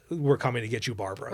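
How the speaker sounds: soft, low spooky murmur; hushed creepy quote. Retold or imitated. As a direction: murmur it like a ghoul. Quoting famous horror line.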